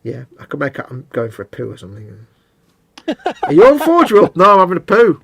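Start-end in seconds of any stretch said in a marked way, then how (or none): 3.4-4.4 mock accusing question. Voicing the other asking. 4.4-5.2 mock excuse. Voicing the reply.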